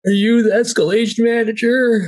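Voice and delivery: laughing shout. Real, not acted. Player jokes, blinded.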